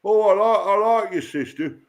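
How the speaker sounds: a hammed-up Yorkshire accent, slow and mocking. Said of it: "If you go to Yorkshire".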